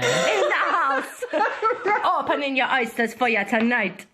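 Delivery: put-on laughing Jamaican accent; amused amateur Jamaican. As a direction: put on Jamaican while cracking up. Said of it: "I can do the Jamaican one".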